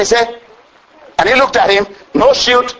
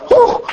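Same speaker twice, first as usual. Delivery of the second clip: ecstatic whew. Real, not acted. Preacher overcome with praise.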